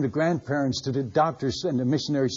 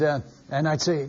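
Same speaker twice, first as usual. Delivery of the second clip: ordinary talk, nothing marked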